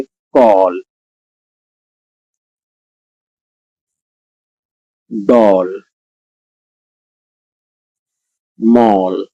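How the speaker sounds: कॉल, डॉल, मॉल, बॉक्स, each with an open ɔ, said slowly; open o words. Shown as a demonstration. Teacher reading example words slowly: open ɔ in each. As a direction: stretch the open ɔ in each word. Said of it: "अब इनसे हम कुछ शब्द बनाते हैं".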